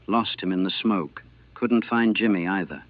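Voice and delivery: usual speaking voice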